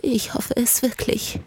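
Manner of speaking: put-on slow, high, fragile old voice; hope aloud slowly, full of worry; anxious old lady, thin and drawn out; brittle, frightened old woman. A narrator's reading. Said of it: "Ihre Stimme war so brüchig ... völlig verängstigt".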